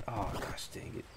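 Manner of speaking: ordinary delivery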